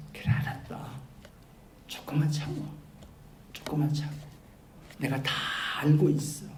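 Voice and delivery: soft, hushed reassurance, partly whispered; calm an impatient child with a soft voice; gentle soothing tone. Pretend. God softly reassuring the crying martyrs, hushed and gentle. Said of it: "이런 투로 말씀하셨습니다".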